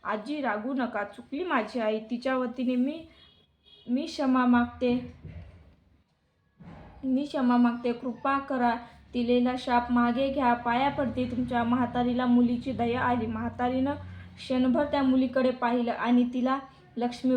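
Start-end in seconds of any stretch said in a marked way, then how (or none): none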